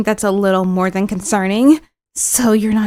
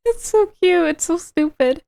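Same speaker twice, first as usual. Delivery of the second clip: high giggly laughter, raised pitch; giggling high over a silly sprite; laughing; 7 semitones higher than usual. Real, not acted. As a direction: laugh it out, delighted.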